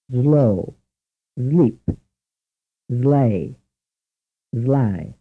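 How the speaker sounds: "Slow", "sleep", "slay", "sly" said with z in place of s, like "zlay"; Italian pronunciation. Shown as a demonstration. Teacher plays the Italian version of "slay" with z for s. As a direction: start "slay" with a voiced z, "zlay". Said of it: "Listen again to the Italian pronunciation".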